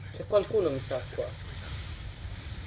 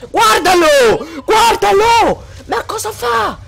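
much higher, louder, slow drawn-out yells; enraged shouting; 11 semitones higher, 4 dB louder than usual. Real, not acted. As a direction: bellow insults at the camper. Gamer shouting at camper. (urla furiose e trascinate)

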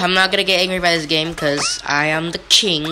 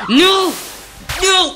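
frustrated shout; 12 semitones higher than usual. Real, not acted. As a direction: yell no at the game. Fails again in game.

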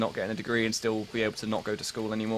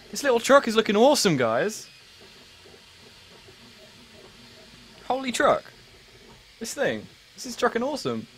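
high-pitched mock amazement; hammed-up excitement; 10 semitones higher than usual. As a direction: gush over it in a raised, playful pitch. Hamming up truck puns.

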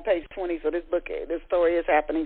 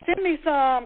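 higher, louder playful begging; 8 semitones higher, 3 dB louder than usual. Acted. Clarissa asking her sister for sauce, playful begging. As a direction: beg for a taste, playfully. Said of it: "Clarissa begged".

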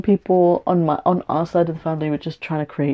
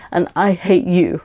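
usual speaking voice

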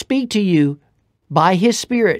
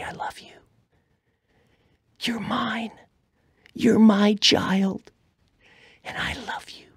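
tender whisper of God's words; 2 semitones higher, 7 dB quieter than usual. Acted. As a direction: whisper as if God speaks softly.

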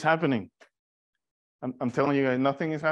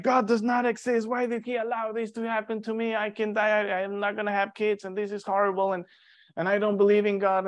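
higher, aggrieved voice of a man railing at God; bitter unbeliever; 7 semitones higher than usual. Retold or imitated. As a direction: play someone shaking his fist at heaven. Imagined bitter man rejecting God.

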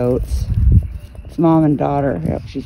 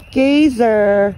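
high sing-song call; 7 semitones higher than usual. Real, not acted. Calling goat, high.

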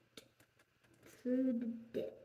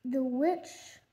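plain reading voice

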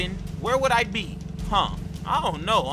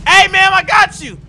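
high, loud holler; triumphant shout; 9 semitones higher, 13 dB louder than usual. Acted. Driver shouting at the woman he has caught, triumphant. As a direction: shout it at a cornered target. Chasing and cornering the thief.

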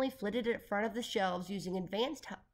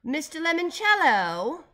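loud, high, drawn-out call; slow, imperious holler; bellow; 9 semitones higher, 11 dB louder, slower than usual. A narrator's reading. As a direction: bellow the name up from below. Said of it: "bellowed a voice below".

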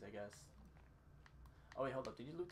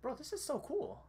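excited; 13 semitones higher than usual. Real, not acted. Excited about the game.

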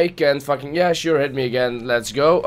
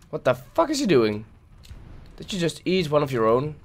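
ordinary talk

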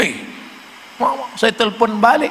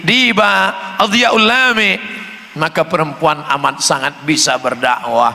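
ordinary delivery

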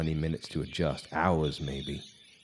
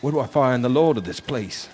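calling out; 6 semitones higher, 8 dB louder than usual. Retold or imitated. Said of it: "I asked".

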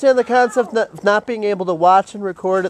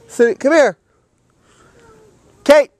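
loud, high call of her name; 7 semitones higher, 7 dB louder than usual. Real, not acted. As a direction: shout her name across the room. Calling the dog by name.

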